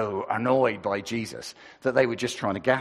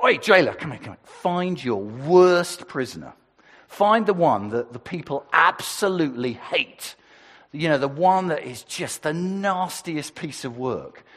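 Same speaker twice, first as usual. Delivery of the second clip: bossy command; 4 semitones higher, 6 dB louder than usual. Acted. Pilate ordering the jailer.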